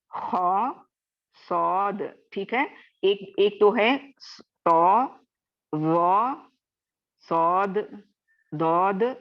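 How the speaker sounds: each heavy letter said slowly and drawn out; Arabic letters recited as in Quran class; reciting Arabic letters. Shown as a demonstration. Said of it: "ये सात letters हैं।".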